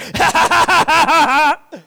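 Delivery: high mock evil cackle; little girl's Doctor Evil laugh; Doctor Evil belly laugh. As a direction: imitate a toddler's villain laugh. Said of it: "It's like Doctor Evil or something".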